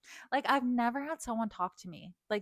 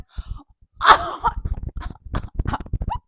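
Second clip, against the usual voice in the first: shrieking disbelieving laugh; high incredulous laugh-cry; 10 dB louder than usual. Real, not acted. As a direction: let out a high shocked laugh. Outraged disbelief.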